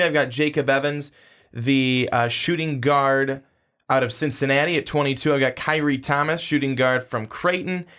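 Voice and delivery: ordinary talk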